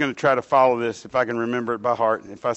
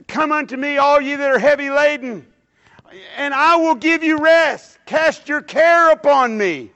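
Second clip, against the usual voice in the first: quoting Jesus; 12 semitones higher, 6 dB louder, slower than usual. Retold or imitated. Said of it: "He said".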